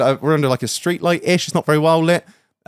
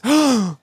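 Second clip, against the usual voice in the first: sharp high mock gasp; imitated shocked gasp; 11 semitones higher than usual. Wife sees his injury in the light, imitated shocked gasp. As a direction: gasp as if seeing the wound. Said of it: "takes one look at me and goes".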